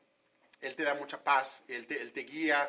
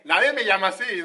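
mock pride; 5 semitones higher, 9 dB louder than usual. Mock proud self-introduction.

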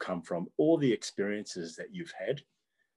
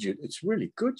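imitated impressed students; 8 semitones higher than usual. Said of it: "'Cause they said".

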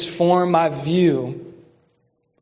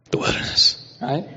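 eerie unvoiced wilderness; spooky whisper; 3 semitones lower, 4 dB quieter than usual. Shown as a demonstration. Preacher whispering the word to his church. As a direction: breathe it out like a ghost story. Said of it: "You should have said it in that voice".

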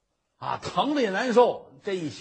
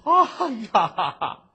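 slow, louder wail of pain; pained cry; 5 semitones higher, 7 dB louder, slower than usual. Acted. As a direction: cry out after the blows. Merchant slapped in the face.

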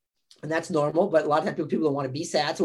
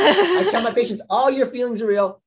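laughing while she speaks, higher and louder; 7 semitones higher, 7 dB louder than usual. Real, not acted. Therapist laughing as she tells the host her advice. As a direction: talk through a real laugh.

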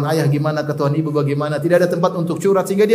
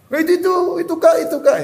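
put-on much higher, quick kid voice pointing someone out; eager student; 13 semitones higher, faster than usual. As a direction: pipe up fast like a schoolboy telling on a friend.

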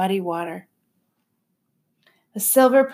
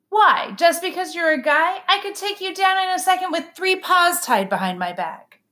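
indignant little sister; 8 semitones higher than usual. A narrator's reading. Sister argues with brother.

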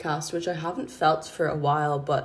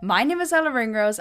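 name said pitched up and louder, lingering on Ella; intro announcer read; 7 semitones higher, 5 dB louder than usual. Shown as a demonstration. Host introducing herself in the show intro, polished announcer read.

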